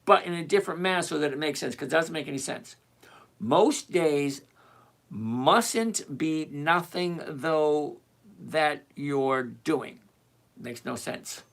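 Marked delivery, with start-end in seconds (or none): 3.4-10.0 very slow, deliberate reading; slow puzzled reread. Shown as a demonstration. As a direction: spell out the muddled question slowly, piece by piece. Rereads the confusing question aloud.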